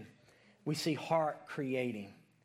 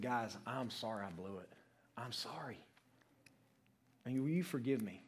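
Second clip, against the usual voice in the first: hushed apology; 7 semitones lower, 9 dB quieter than usual. Retold or imitated. Retold apology to his coworkers.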